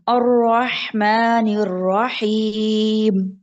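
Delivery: slow Quran recitation, long vowels. Shown as a demonstration. Teacher reciting for Saadia to repeat. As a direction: recite it slowly for the student.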